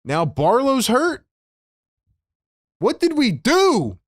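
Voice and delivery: slow, high, disbelieving outcry; exasperated. Real, not acted. Fan seeing his star hurt, high exasperated outcry. Fan upset by another injury.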